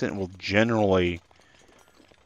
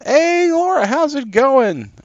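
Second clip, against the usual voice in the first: cheery greeting; 5 dB louder, slower than usual. Real, not acted. Greets a viewer in chat.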